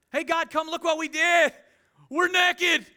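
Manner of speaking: loud, high-pitched playful holler; mock excited shout. Preacher mocks Adam and Eve hollering to God, mock shout. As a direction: call out in comic, put-on glee.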